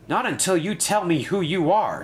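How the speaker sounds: high, mildly insistent refusal; firm demand. A narrator's reading. Ryan challenges the stranded stranger, moderately firm. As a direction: stand your ground, a touch firmly. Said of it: "said Ryan firmly".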